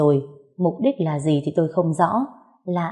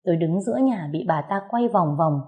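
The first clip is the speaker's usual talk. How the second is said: ordinary delivery, nothing marked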